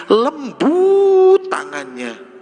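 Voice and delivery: high, very slow and tender; tender longing. Acted. Blind man remembering the Prophet's gentle hands, slow and tender. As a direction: linger on it like a lost loved one.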